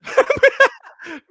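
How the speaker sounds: big high-pitched cackle; loud high laugh. Real, not acted.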